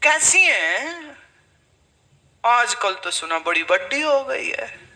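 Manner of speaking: कैसी drawn out slow with a falling, sneering tune; snide mock question. Relative asking about the teacher with a snide slow कैसी. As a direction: sneer out कैसी slowly, as a loaded jibe. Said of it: "सीधी तो बात करनी नहीं ना कोई".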